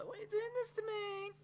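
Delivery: soft, very high mock plea; Mandy Rose impression. Host repeating a wrestler's line, soft squeaky imitation. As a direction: squeak it out small and softly. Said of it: "just Mandy Rose on the floor going".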